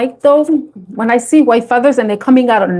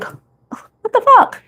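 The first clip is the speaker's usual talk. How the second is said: incredulous; 12 semitones higher, much slower than usual. Retold or imitated. Mocking apocalyptic warnings.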